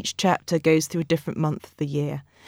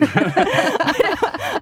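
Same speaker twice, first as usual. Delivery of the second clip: high laugh; 13 semitones higher than usual. Real, not acted. Laughing at father's bleak verdict.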